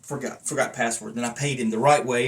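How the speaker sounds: ordinary talk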